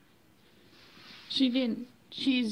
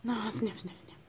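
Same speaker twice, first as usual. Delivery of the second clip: fading to an unvoiced whisper; hushed whisper; 8 dB quieter than usual. Real, not acted. Telling the sniffing cat softly in a whisper. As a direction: hush it down to a whisper.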